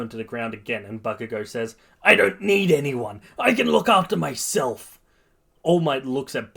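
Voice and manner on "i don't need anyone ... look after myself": loud, high protest; angry defiance. A narrator's reading. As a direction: insist on it heatedly. Bakugo pinned, refusing help.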